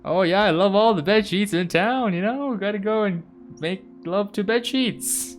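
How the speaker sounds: high, loud, silly gushing; mock-enthusiastic clowning; hammed-up goofy riff. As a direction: belt out a joke with voice pushed up. Joking riff on the cat's name.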